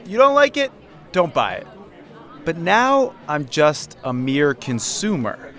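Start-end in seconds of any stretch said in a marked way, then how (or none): 0.0-1.7 snarky vendor. Retold or imitated. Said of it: "And I would be like".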